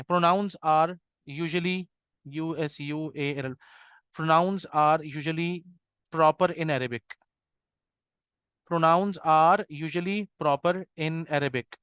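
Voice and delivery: very slow, word by word; teacher dictating the rule slowly; slow dictation. Shown as a demonstration. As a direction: dictate it so students can write. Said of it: "all pronouns कह सकते हैं आप".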